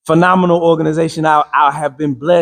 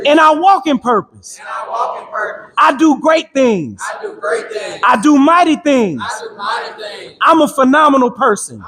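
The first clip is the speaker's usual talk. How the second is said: fervent preacher cadence; rousing motivational preaching; 9 semitones higher than usual. Acted. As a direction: drive it home like a sermon to the crowd. Leading crowd affirmations.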